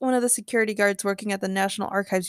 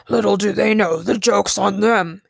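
evil gorilla; 5 dB louder than usual. A narrator's reading. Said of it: "Gorilla Grodd then smiled evilly".

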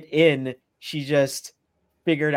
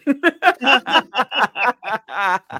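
high laugh; 8 semitones higher, 4 dB louder than usual. Real, not acted. Laughs at own pitch.

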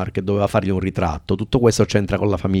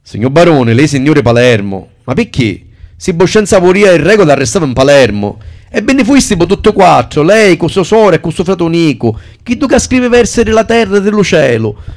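loud, high broken Sicilian dialect; 9 semitones higher, 10 dB louder than usual. Acted. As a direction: rattle it off in coarse Sicilian dialect. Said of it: "in maniera sgrammaticata e volgare". (dialetto siciliano sgrammaticato)

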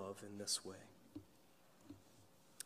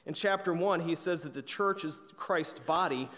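higher and louder, rising preacher's fervor; preaching at full force; 5 semitones higher, 7 dB louder than usual. Acted. Pastor stirring his congregation on God's love, fervent preaching. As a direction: lift the congregation with each clause. Energetic scripture run.

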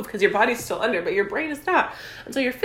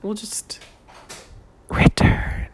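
whisper; 3 dB louder, much slower than usual. Pretend. Mock dramatic break announcement.

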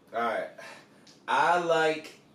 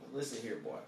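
Southern drawl; 9 semitones lower, 10 dB quieter, faster than usual. Shown as a demonstration. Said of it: "you just say".

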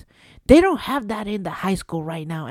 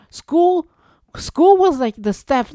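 loud exasperated rant; 7 semitones higher, 6 dB louder, slower than usual. Real, not acted. Ranting about school lunch.

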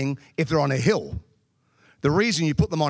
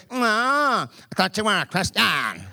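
mocking coworker; 2 semitones higher, slower than usual. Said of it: "She goes".

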